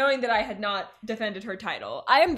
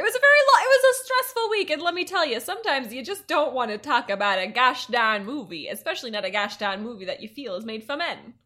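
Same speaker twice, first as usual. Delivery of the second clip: put-on Mid-Atlantic accent; 3 dB louder than usual. Co-host complains of stressful week, very strong Mid-Atlantic accent. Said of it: "I started doing an accent".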